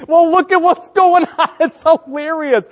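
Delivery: high, loud gleeful voice; amused delight. Retold or imitated. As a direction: point at the mess, thrilled. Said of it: "And I'm just like".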